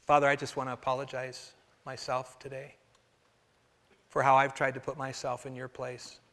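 soft, humble prayer voice; a pastor praying for the church, humble and soft. Acted. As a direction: pray quietly for the congregation. Leads a prayer.

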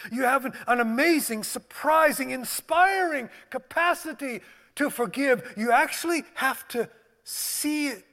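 loud, high fervent preaching. Acted. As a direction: build it up like a revival preacher. Preaches at full force.

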